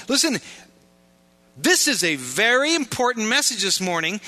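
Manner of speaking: preaching at full force. Acted. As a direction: press it home to the congregation.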